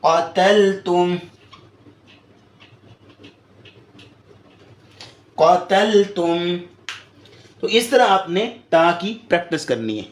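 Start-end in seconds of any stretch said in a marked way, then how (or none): 0.0-6.6 قتلتم drawn out very slowly; slow Quranic recitation. Shown as a demonstration. Teacher gives another example, slow recitation. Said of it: "ایک اور example لے لیں".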